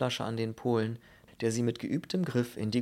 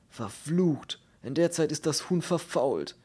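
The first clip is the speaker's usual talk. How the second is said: higher, louder anguished outburst; exasperated groaning complaint; cursing in dismay; 6 semitones higher, 5 dB louder than usual. Acted. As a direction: curse as your precious food is lost. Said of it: "Der Glatzkopf stöhnte".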